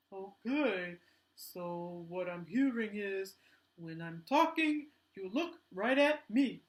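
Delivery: slow, careful puppet voice; the puppet working it out aloud; slowly repeating back as the puppet. Pretend. Puppet checks the lesson.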